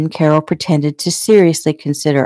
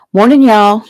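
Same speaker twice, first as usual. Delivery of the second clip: called out; 6 semitones higher, 7 dB louder than usual. A narrator's reading. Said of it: "she called".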